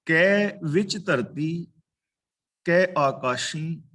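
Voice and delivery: slow, measured verse reading; drawn-out sacred verse; scripture recitation. Real, not acted. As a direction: recite the line slowly, word by word.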